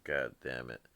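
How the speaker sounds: ordinary delivery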